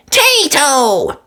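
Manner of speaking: put-on very high excited toddler shout; baby George cheering. A narrator's reading. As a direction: squeal it like a tiny boy. George voiced.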